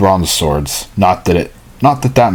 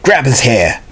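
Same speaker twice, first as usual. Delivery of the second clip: put-on higher-pitched would-be Jersey accent; 4 semitones higher than usual. Bully telling her gang to seize him, Jersey accent. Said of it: "I don't know why I'm giving them that accent".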